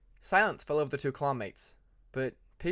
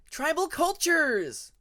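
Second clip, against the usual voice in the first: much higher, slow, bright announcement; cheerful call; 13 semitones higher, 6 dB louder, much slower than usual. A narrator's reading. Hyena calling out the first class to the winglet. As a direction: sing it out from the next cave. New voice calls from the cave.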